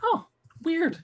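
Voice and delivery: surprised. Acted. In-character surprise.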